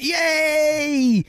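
squealed high, loud cheer of soldiers; a shrill mocking yay from the crowd. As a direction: soldiers whooping back.

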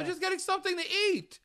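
high, fast, dopey excuse; mock innocent high voice; high mocking predator voice. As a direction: squeak it out like a caught creep. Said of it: "He's like".

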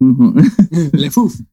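muddled mock French with a Southern tinge; goofy Texas-French accent on an oof. Joking over who wins, botched French Southern accent.

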